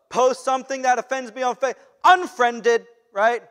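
loud, snapping "unfriended"; hammed-up outrage; mock indignant unfriending. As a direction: slam the door on the friendship. Acts out unfriending in anger.

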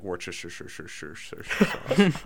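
Worcestershire with the "sure" syllable repeated over and over; stuttering string of sure, sure, sure; imitated garbled catchphrase. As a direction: imitate a cook stumbling on purpose through Worcestershire. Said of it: "he's always like".

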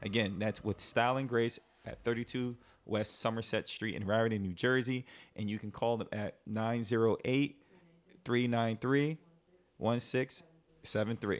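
friendly host ad read over music; host reading a sponsor spot to listeners, upbeat; radio ad read. Acted. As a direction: pitch the salon to the ladies. Reads a salon ad.

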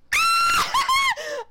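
high, loud delighted scream; shrieking squeal. Retold or imitated. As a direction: let out a wild, shrieking squeal. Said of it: "and I'm like".

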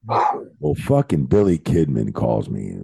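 ordinary talk, nothing marked

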